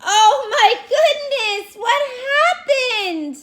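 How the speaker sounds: hammed-up gasp of wonder, high and loud; mock surprise. Teacher wowing her class over the picture, hammed-up surprise. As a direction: act amazed for the kids.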